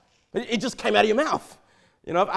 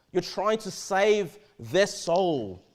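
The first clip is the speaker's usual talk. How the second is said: drawn out slowly, stress on "their"; slow emphatic stress; much slower than usual. Acted. Preacher urging the church to save souls, slow emphasis. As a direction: lean on "their" and slow right down.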